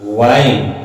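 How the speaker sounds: वाइन starting with a V, lips drawn inward. Shown as a demonstration. Teacher shows students how lips go inward, V-sound pronunciation. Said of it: "होंठ अंदर की ओर आ रहा है".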